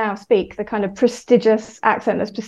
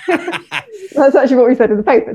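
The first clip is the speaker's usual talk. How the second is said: louder, higher, through laughter; laughing; 4 semitones higher, 4 dB louder than usual. Real, not acted. Linguist laughing as she defends her paper's grouping. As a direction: laugh while defending the point. Amused at own finding being questioned.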